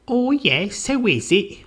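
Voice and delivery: high, piping housekeeper answering. Acted. Housekeeper answering a visitor at the door, high-voiced. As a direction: pitch it way up for the housekeeper.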